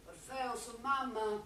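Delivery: softly, calling at night, voice kept down; calling her son gently so as not to wake anyone; hushed call. Acted. (chiamata sommessa, a mezza voce)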